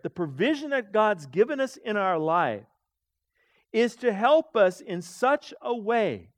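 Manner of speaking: ordinary delivery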